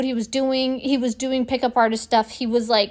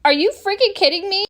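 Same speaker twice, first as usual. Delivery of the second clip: high, louder disbelief; are you kidding me, pitched up high; incredulous; 8 semitones higher, 4 dB louder than usual. Retold or imitated. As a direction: replay her shocked reaction. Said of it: "And I go".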